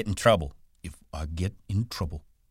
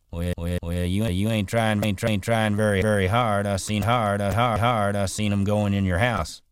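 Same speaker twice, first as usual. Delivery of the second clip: teasing Slim; 4 semitones higher, 5 dB louder than usual. A narrator's reading. Slim teases her, voiced.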